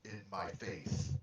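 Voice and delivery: put-on grave, measured old believer; solemn in-character voice. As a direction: speak as a devout elder. In-character speech in the game.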